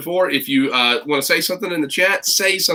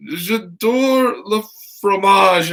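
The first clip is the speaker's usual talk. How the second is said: mock French; 6 semitones higher, much slower than usual. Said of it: "And I said".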